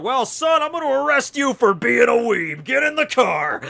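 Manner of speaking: high, loud hammed-up cop; mock cop voice. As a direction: play the stern cop for laughs, up high. Mocking cop arresting him.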